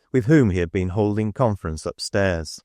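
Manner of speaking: ordinary delivery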